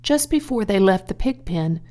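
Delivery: usual speaking voice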